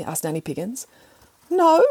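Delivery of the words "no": high cat no. Acted. Cat answering.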